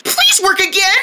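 high, sweet begging tone; pleading please. Pretend. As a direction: ask nicely, pleading. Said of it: "Let's try saying please".

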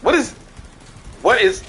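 sudden alarmed cry, pitch jumping high; startled shout. Real, not acted. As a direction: yelp in shock as shots hit.

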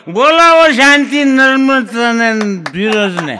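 put-on loud, laughing drunken retort; drunk mock outrage. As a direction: shout it back, mocking the advice. Drunk mocks the manager's advice. (નશામાં હસતાં હસતાં મોટેથી ટોણો)

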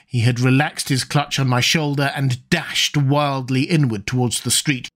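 ordinary delivery, nothing marked

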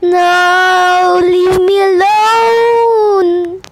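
very high shrieking cries, slow; terrified horror victim. Real, not acted. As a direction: scream like you're being chased. Being chased in game.

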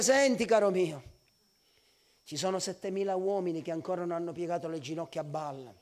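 low, soft, settled reply; quiet low-voiced reassurance; calm correction. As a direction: bring it right down, like God patiently answering a complainer. God calmly answers Elijah's complaint.

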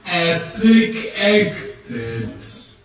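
slow grand announcement. Pretend. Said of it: "You'd be like".

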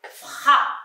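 "Fra" sounded out slowly, unvoiced; teacher sounding out "Fra"; syllable fra. Shown as a demonstration. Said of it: "Je dis fra".

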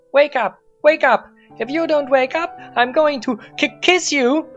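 put-on high, loud, bossy anime girl; tsundere voice. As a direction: shriek it like a pouty anime girl. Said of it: "with a tsundere voice".

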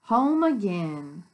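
loud, slow storybook reading; read-aloud to children. A narrator's reading. Teacher reads the bus book to children, slow read-aloud.

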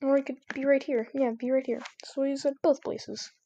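ordinary delivery

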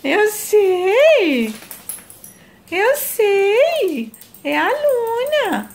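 high, slow cooing; high baby talk to her daughter. Acted. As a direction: coo it like talking to an infant. Mother coos to baby.

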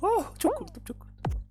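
sudden high startled gasp of relief; startled cry. Real, not acted. Gamer startled by a jump scare, high yelp.

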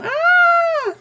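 shrill excited shriek; high squeal. Real, not acted.

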